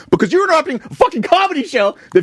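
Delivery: fast, high mock lecture at the heckler; high exasperated rant. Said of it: "and I explained to him".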